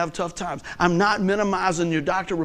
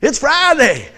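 loud, high imitation of thrilled coworkers; cranked-up Friday cheer; 7 semitones higher, 8 dB louder, slower than usual. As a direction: whoop it like the weekend arrived. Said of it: "they don't get cranked up till Friday".

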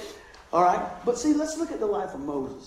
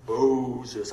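movie God voice; 9 semitones lower than usual. Retold or imitated. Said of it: "I love that part of the Ten Commandments".

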